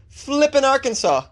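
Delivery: high, delighted cry of Flippin; gleeful disbelief. Real, not acted. Host delighting in the town name Flippin with high disbelief. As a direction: savour the absurd name.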